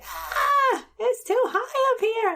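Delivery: high-pitched mock-panicked kid voice; gasp, then wail it high and drawn out; retold high whiny fright; imitating scared child. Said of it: "he was like".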